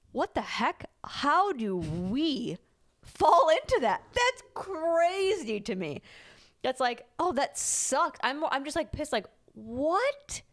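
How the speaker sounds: high, incredulous rant; exasperated disbelief. Real, not acted. Partner vents frustration about their fights, exasperated. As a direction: vent at how crazy it is.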